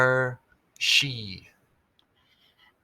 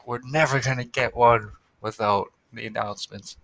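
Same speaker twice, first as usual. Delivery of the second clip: usual speaking voice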